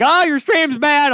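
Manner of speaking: loud, high, drawn-out mock complaint. Viewer texting the hosts during the show, mock complaining. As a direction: imitate a griping viewer, high and stretched out.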